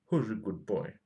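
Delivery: doggy praise at normal pitch; praising a dog. Pretend. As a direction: coo it like to a pet, voice unraised. Cooing praise at the circuit board.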